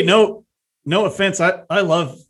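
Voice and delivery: normal talking voice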